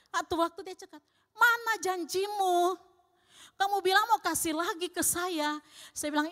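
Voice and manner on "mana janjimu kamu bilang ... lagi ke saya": mock angry demand. Angry neighbour quoted. (tetangga menagih janji dengan marah, suara meninggi)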